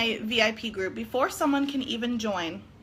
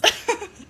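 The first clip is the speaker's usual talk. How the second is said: high bursting giggle; 10 semitones higher, 5 dB louder than usual. Real, not acted. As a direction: crack up at your own joke. Laughs at her doo-doo joke.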